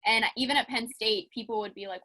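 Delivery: ordinary talk, nothing marked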